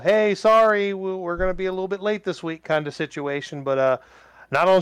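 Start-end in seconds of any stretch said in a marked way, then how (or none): 0.0-2.7 high, quick mock apology; apology message voice. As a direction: read it like a sheepish notice. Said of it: "do the old".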